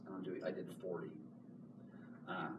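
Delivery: ordinary talk, nothing marked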